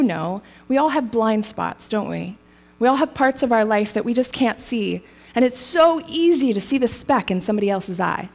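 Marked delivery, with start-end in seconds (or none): none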